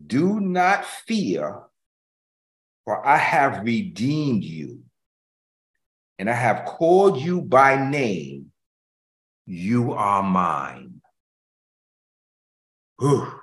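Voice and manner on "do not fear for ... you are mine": slow, weighty reading of the verse; slow scripture reading. Acted. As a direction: let each word of the promise land slowly. Preacher reads scripture slowly.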